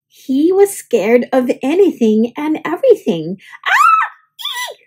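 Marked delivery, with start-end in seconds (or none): 3.7-4.7 very high shrieking scream; frightened scream. Acted. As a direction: let out a startled kid's shriek. Scaredy Sam frightened by everything.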